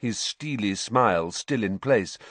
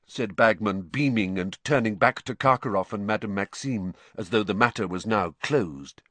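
plain reading voice

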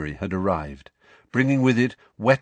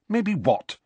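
impatient snap, pitched up high; snappish; 10 semitones higher than usual. A narrator's reading. Hermione presses Ron impatiently, snappish and higher. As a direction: bite the question off impatiently. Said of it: "said Hermione rather snappishly".

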